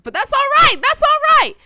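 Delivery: very high, loud squeal; mock-hurt yell. Insisting it's all right, in a high mock-hurt yell. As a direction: overplay being fine about it.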